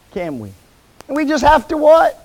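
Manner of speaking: usual speaking voice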